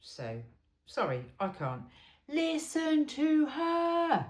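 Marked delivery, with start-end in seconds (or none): 2.3-4.2 slow, drawn-out yell, higher and louder; a drawn-out shouted plea to really listen. Real, not acted. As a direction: bellow each word slowly to drive it home. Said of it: "Can I say it one more time without yelling? sorry, I can't".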